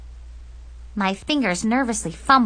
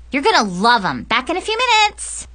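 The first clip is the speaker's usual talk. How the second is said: high, eager sing-out; cheery exit; 6 semitones higher, 4 dB louder than usual. Acted. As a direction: pitch soaring on the way out.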